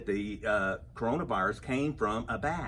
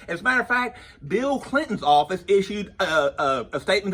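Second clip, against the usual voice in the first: high, loud worked-up burst; comic excited outburst; 5 semitones higher, 8 dB louder than usual. Acted. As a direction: blurt it out all fired up. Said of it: "I'm getting all excited about this".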